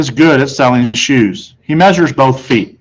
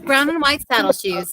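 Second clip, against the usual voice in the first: higher, soft prompt; mother reminding; 11 semitones higher, 8 dB quieter than usual. A narrator's reading. Mother reminding the salesman, higher voice. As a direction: remind him politely. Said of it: "my mother reminded him".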